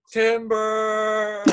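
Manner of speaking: high called-out "Timber!" as he dives; mocking call. Opponent taunting Josh as he dives, high mocking call. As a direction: yell it up high like a tree falling, teasing. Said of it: "Jace goes".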